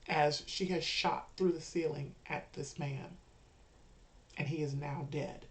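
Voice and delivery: ordinary delivery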